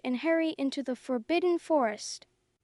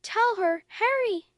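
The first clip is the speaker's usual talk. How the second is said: higher, louder urgent shout; raised urgent plea; 6 semitones higher, 4 dB louder than usual. Acted. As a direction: call out to make him speak. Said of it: "Hermione shouted".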